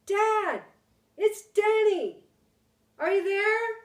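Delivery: high loud shout into the woods; a boy shouting through a dark wood; calling out. Acted. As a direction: call as loud as you can for dad. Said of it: "I shouted".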